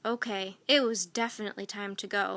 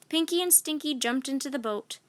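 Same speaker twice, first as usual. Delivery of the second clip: usual speaking voice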